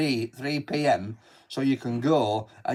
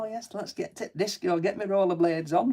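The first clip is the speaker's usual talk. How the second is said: imitating his wife; 6 semitones higher than usual. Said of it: "She's like".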